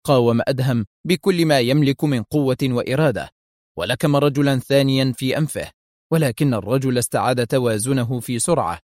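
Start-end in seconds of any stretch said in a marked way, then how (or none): none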